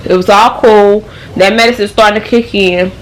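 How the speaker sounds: ordinary delivery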